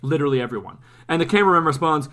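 ordinary talk, nothing marked

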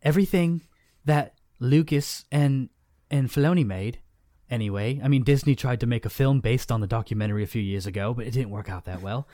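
amused imitation of a British accent; attempted British accent. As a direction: try on a British accent, half-hearted. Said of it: "Do your best".

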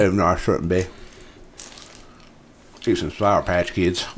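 talking while eating candy; chewing through the words; mouth full. Real, not acted. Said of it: "Sorry, eating some candy tonight".

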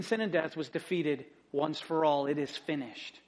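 hushed sermon reflection; quiet sermon. Acted. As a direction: draw them in gently. Slow quiet sermon turn.

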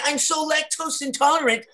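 high, prissy put-on voice; mock a fussy customer demanding almond milk; whiny customer voice. Fussy customer ordering almond milk, high and prissy.

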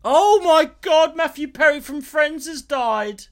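high, loud, slow mock-dramatic gasp of news; mock shock. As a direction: overplay the shock of breaking news. Bursting in with celebrity death news.

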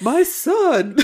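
loud, high mock-stage cry; hammed-up stage mother claiming her child; theatrical stage voice. Podcaster mimicking Rick's mother, theatrical stage voice. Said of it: "It sounds like a stage voice".

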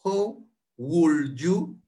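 "How would you" stretched very slowly; very slow demo. Shown as a demonstration. Teacher breaks the question down for students, very slowly. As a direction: break it down word by word. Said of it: "How, ¿cómo?".

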